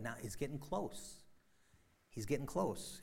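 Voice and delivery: normal talking voice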